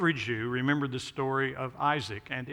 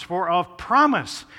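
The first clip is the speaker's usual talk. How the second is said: "promise" punched higher and louder; stress on promise; 3 semitones higher, 7 dB louder than usual. Shown as a demonstration. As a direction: lean hard on "promise", lifting it above the other words. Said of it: "puts the emphasis on the word promise".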